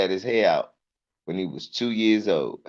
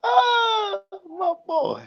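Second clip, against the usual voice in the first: much higher proud cry; proud delight; 3 dB quieter, much slower than usual. Real, not acted. Comic gushing over his son's toughness.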